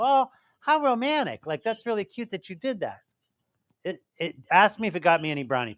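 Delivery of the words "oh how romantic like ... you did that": high, swooning opening, easing off; imitated admiring women. Man imitates women admiring his tattoo, high gushing voice. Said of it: "most of the women I show it to go".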